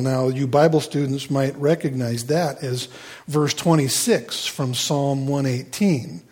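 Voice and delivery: usual speaking voice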